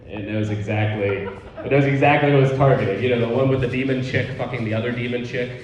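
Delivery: ordinary delivery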